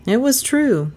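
plain reading voice

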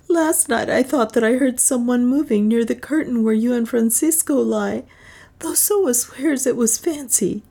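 high-pitched tearful woman's lament; weeping bitterly. A narrator's reading. Juana begs Leonard for a way out, weeping. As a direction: plead high, as if through tears. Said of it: "weeping bitterly".

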